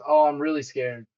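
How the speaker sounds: loud, hammed-up fake fright; badly faked fear with a big "oh"; mock a frightened investor; mock scared. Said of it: "You're scared of this".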